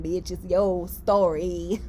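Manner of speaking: a hint of a sassy signature line, laughing; Akasha's catchphrase. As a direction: say it slow with a grin, as her signature line. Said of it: "Akasha way of saying".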